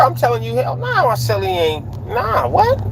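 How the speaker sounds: very high-pitched indignant denial; scandalized, high-pitched "hell nah"; emphatic denial. Acted. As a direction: deny it like it's absurd, voice leaping up. Acts out his own indignant denial.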